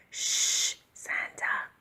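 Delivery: hushed whispered shush. A narrator's reading. Reindeer hushing Santa before the children wake, whispered. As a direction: breathe it without voice. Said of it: "whispered the reindeer".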